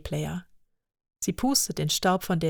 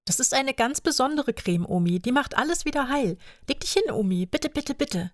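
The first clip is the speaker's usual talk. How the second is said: put-on little girl pleading; 7 semitones higher than usual. Child Lea pleading with grandma.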